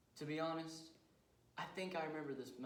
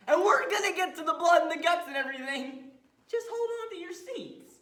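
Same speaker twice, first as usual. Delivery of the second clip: very high, loud showman's promise; frenzied high-pitched yell; excited shout; 17 dB louder than usual. Acted. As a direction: whip the audience up at full volume. Killer hypes the audience.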